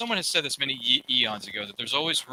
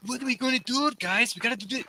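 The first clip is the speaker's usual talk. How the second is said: high-pitched take on a Nightcrawler accent; high demo of Nightcrawler's accent; 9 semitones higher than usual. Shown as a demonstration. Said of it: "Do you do the accent?".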